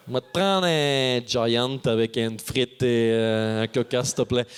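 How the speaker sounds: drive-thru order. Retold or imitated. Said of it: "je fais".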